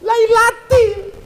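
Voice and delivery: high, slow lailatin; correct ending. Shown as a demonstration. Preacher completes the verse correctly, high and drawn. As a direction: finish the word with the right case ending. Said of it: "berarti setelah fi pasti lay".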